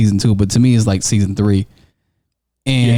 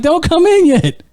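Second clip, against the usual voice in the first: daughter voice. Retold or imitated. Daughter voiced from behind door.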